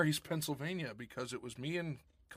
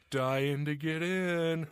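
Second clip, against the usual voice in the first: drawn-out, louder punchline delivery; slow punchline; 3 semitones higher, 5 dB louder, much slower than usual. Acted. To Rob, milking the cemetery pun, slow and drawn out. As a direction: land the pun slowly and deliberately.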